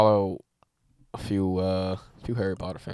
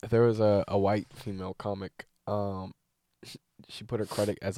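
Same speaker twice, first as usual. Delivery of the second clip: usual speaking voice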